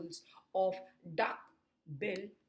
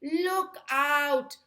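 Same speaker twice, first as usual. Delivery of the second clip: high, loud alarm call; urgent storybook yell; yelled warning; 9 semitones higher, 12 dB louder than usual. A narrator's reading. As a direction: shout a warning to them. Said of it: "who yelled".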